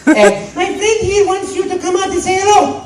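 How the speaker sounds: high, bright plumber; Mario voice. Retold or imitated. Mario asking Luigi to greet the child, a high bright voice. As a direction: ask your brother nicely. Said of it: "And I go".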